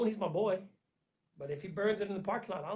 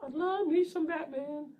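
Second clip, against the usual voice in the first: higher and slower, stretched out with feeling; emotional; 8 semitones higher, 3 dB louder than usual. Real, not acted. Declaring his love for Batman, higher and slower. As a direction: draw it out high, full of love. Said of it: "I'm getting emotional".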